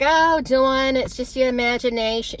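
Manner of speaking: high, loud brushing-off mom; dismissive mom. Retold or imitated. Mom dismissing her scared daughter, loud and high. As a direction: wave it away like a brisk mom. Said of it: "And she was like".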